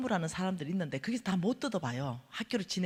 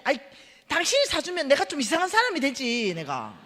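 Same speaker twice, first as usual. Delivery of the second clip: higher, louder cross retort; irritated shout; 5 semitones higher, 6 dB louder than usual. Retold or imitated. Refusing her husband's offer, irritated shout. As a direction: snap it back. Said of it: "내가 막 이러니까".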